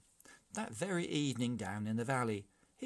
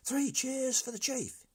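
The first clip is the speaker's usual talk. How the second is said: much higher loud cheer; cheering yell; 14 semitones higher, 4 dB louder than usual. A narrator's reading. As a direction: shout the cheer out. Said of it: "yelled Giorgio".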